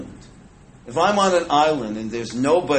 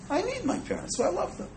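put-on much higher, like a small needy child; childish voice; 5 dB quieter, faster than usual. To the congregation, mimicking a child's love, high childlike voice. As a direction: play the child who loves because he needs. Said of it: "The love of a child is".

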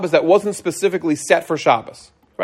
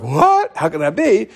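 surprised; 3 semitones higher, slower than usual. Retold or imitated. Shocked at store open on Shabbat.

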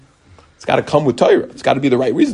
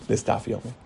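usual speaking voice

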